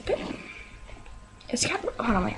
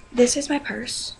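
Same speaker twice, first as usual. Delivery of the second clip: usual speaking voice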